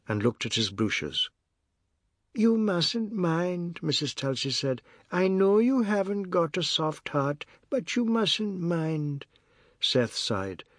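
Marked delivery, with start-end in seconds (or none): 2.3-3.8 high, very slow matriarch voice; elderly-matriarch character voice, slow and pitched up; Missus Tulsi's soothing plea. A narrator's reading. 5.1-9.3 high, very slow matriarch voice; elderly-matriarch character voice, slow and pitched up; Missus Tulsi's soothing plea. A narrator's reading.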